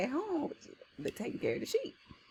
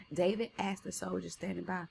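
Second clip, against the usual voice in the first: ordinary delivery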